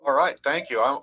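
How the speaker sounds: ordinary talk, nothing marked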